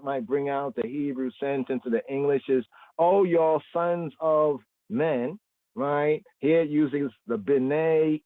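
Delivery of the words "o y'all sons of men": slow, higher solemn reading of the line; scripture reading. Acted. Teacher reading a second translation aloud, slow raised reading voice. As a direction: lift the verse out slowly. Rereads the verse in another translation.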